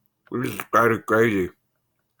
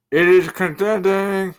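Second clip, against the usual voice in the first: high, slow burp through the words; 9 semitones higher, 4 dB louder, slower than usual. Shown as a demonstration. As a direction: ride a big burp through the phrase.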